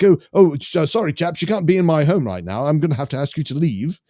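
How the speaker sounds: mock-polite British scolding of intruders; hammed-up British accent asking chaps to leave; classy British accent. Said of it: "probably with that nice British accent".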